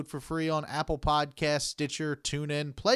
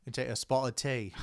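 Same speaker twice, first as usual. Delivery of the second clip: mock British accent; 3 semitones lower, 5 dB quieter than usual. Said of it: "put on your best British accent".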